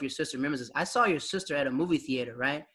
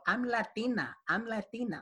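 lightly mimicked mother, pitched up; higher, teasing sing-out, slightly softer; playful imitation of a mom poking fun; mother making fun; 6 semitones higher, 4 dB quieter than usual. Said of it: "My mother used to make fun and go".

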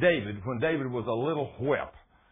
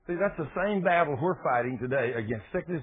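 normal talking voice

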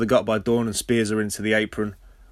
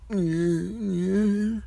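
long high drawn-out mumble; high-pitched dopey non-answer; imitated wordless reply; 9 semitones higher than usual. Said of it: "Al goes".

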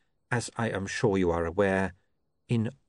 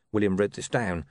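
ordinary talk

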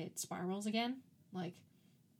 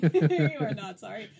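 louder laughing accusation; laughing harder; 12 dB louder than usual. Real, not acted. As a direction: insist through laughter.

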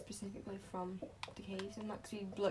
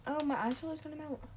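fast, higher panicky joke; joking then panicking; 5 semitones higher, much faster than usual. Real, not acted. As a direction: rattle it off in mock panic.

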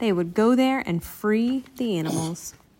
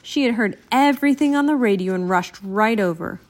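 ordinary delivery

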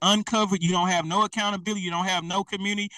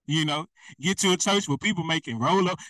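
usual speaking voice

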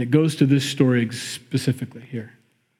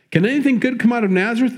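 "Can anything good come out of Nazareth?" thrown out faster and higher, scornful; scoffing disbelief; 9 semitones higher, faster than usual. Retold or imitated. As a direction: sneer at the idea, as if nothing good could come from "Nazareth". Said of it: "He doesn't buy it".

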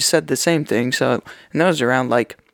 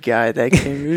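normal talking voice

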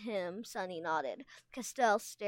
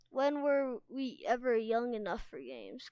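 ordinary delivery, nothing marked

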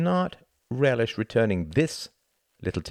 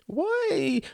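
quavering. A narrator's reading. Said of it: "his voice was quavering".

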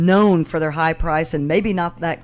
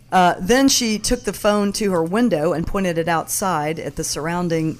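ordinary talk, nothing marked

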